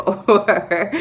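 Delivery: ordinary talk, nothing marked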